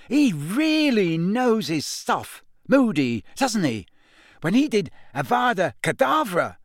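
put-on boy raving to his friend about the lesson, excited; impressed and excited. A narrator's reading. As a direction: gush about the show. Ron excitedly recounting lesson.

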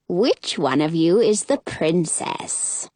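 a fake-smiling stranger; sinister woman. A narrator's reading. Strange woman voiced, fake smile.

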